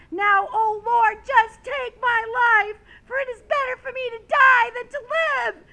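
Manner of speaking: high, loud, fed-up outburst; loud melodramatic tantrum; angry prayer. Acted. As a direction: cry out to God in hammed-up despairing anger. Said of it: "greatly displeased and became angry".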